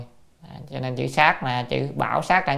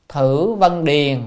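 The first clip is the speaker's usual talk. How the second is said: slow, louder quoted reading; classical recitation; 5 dB louder, much slower than usual. Real, not acted. Teacher quotes the old text, slow loud recitation. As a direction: stretch out each word of the quotation.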